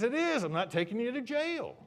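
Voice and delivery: high, loud comic outburst; exasperated cop shout. Retold or imitated. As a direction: bellow the comeback as a fed-up trooper. Imitated officer shouts back at driver.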